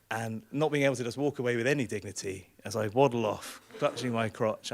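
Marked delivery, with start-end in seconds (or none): none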